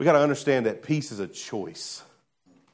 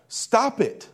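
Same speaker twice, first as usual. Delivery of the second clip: blunt command; 7 semitones higher than usual. Acted. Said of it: "here's why he said it this way".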